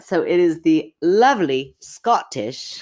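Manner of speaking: put-on British accent, slow and drawn out. Host announcing the guest in a put-on British accent. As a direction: stretch it out in a posh British accent. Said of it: "which I said in a British accent".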